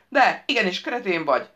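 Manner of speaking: loud, jeering comeback; gleeful, emphatic contradiction; cackling retort. A narrator's reading. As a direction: throw it back tauntingly. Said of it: "vihogta a megmentett".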